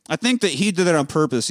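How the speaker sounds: ordinary talk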